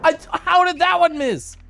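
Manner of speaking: high-pitched, loud protest; loud disbelief. Real, not acted. Streamer cannot believe the shot missed, high loud disbelief. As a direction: cry out in baffled disbelief. Exasperated after missing again.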